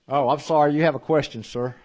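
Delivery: high, loud call to someone. Real, not acted. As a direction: call him back, surprised. Chair calls witness back up.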